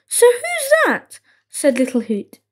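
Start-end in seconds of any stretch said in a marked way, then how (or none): 0.1-1.2 high, slow wondering question; puzzled surprise. A narrator's reading. Little Hoot wondering who is sleeping, puzzled. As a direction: ask slowly, pitched right up.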